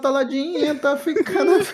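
high-pitched laughing mock yell. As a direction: squeal it like a panicking driver. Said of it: "Enquanto o Sainz tava gritando".

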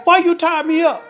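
high, loud indignant question; indignant demand. A narrator's reading. Tied-up husband questions his wife, indignant demand.

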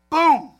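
sudden loud, high shouted boom; gunshot boom. Retold or imitated. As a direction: blast it out like a gun going off. Gunshot in anger metaphor.

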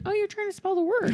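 put-on high cooing at a small child; finding it endearing. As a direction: melt at a kid's cute effort. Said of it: "which is also equally endearing".

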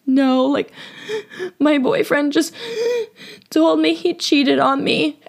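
high-pitched mock sobbing, broken by gasps; imitated bawling. Crying cashier explaining to a customer about her boyfriend, mock bawling. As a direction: act out crying too hard to get the sentence out. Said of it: "bawling my eyes out and I couldn't even get a sentence out".

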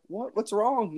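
high-pitched baffled question; retold bewilderment. Retold baffled reply to angry wife.

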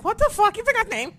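high, loud indignant mock yell; Amira yelling. Friend scolding over a forgotten name, imitated high yell. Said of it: "Amira's probably yelling at me like".

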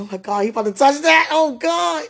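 high, loud breathless "oh my God"; panicked excitement. Retold or imitated. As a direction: squeal with nervous thrill.

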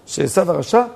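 ordinary talk, nothing marked

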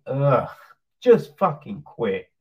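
ordinary delivery

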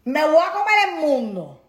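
cocky vow to conquer the world; bold vow. Pretend. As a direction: announce it like you own the day. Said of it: "para que tú digas".

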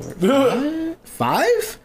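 high, rising, questioning squeak; incredulous. Retold or imitated. Retelling counting all the body pillows, incredulous. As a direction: pitch shoots up in disbelief. Retold disbelief at how many pillows.

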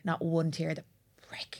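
real whispered defiance; defiant whisper. Real, not acted. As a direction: hiss the vow under your breath. Defiant vow not to cry.

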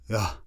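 one-syllable grunt of displeasure; barely bother to answer, just grunt; grunted yeah. Retold or imitated. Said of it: "You get a grunt".

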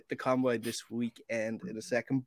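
ordinary talk

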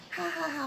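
small, soft, high "ha, ha, ha"; demure fake laugh. Shown as a demonstration. Imitating the polite laugh put on for a new acquaintance, demure. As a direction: titter politely, holding the real laugh back. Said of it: "hindi ako ganun tumawa eh".

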